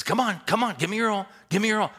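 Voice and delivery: high, loud, insistent urging; yelling coach. Retold or imitated. Coach to blindfolded kid in drill, yelling him on. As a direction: holler at the player to keep moving.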